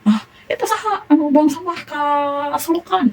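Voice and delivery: teacher acts out a rude, mock-angry rebuke of a litterer; mock angry scolding. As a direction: play the rude neighbour snapping at a litterer, mock-cross. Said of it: "jangan intonasi yang marah-marah".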